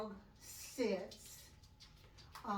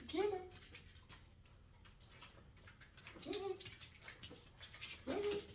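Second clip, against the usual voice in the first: high, bright cue to the dog; firm dog commands; 10 semitones higher, 7 dB quieter than usual. Shown as a demonstration. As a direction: call the cue word out high. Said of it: "Get it means you have permission".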